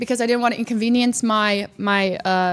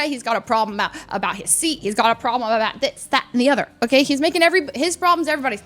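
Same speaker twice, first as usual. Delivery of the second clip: ordinary talk, nothing marked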